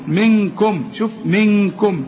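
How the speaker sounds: منكم with the ن hidden in a long hum before ك; held ghunna. Shown as a demonstration. Teacher demonstrates nasalizing منكم, the noon held as a hum. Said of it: "هنغن".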